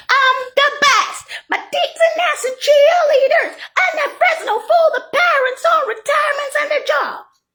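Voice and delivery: high, slow, shouted bragging voice; squealed mock victory cry; mock boast. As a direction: ham up a crowing idiot, high and yelling.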